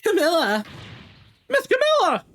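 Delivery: excited little dog. Acted. The dog calls out to its girl, excited and high. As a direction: voice the dog calling for its friend.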